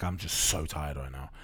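slow and low, quietly drained; a burnt-out founder admitting exhaustion, low and slow; tired, worn out. Retold or imitated. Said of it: "I was like".

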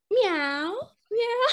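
high imitated cat meows. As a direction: mew like a cat, high-pitched. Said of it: "It's just me going".

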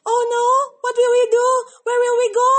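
loud fast frightened cries; panicked. Acted. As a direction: blurt it out in panic. Elephants panic.

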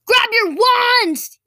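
loud, high, drawn-out battle order; yell. A narrator's reading. Boy rallies friends fallen to the ground, loud yell. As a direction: yell to rally your friends. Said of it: "yelled Zarius".

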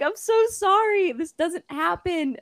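high-pitched tearful apology, imitated; holding back tears. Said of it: "holding back tears".

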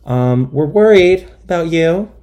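mock concerned friends. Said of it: "And they were like".

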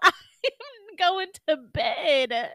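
slow, laughing through the words; slow laughing exit line. Retold or imitated. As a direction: laugh it out while storming off. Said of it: "I said".

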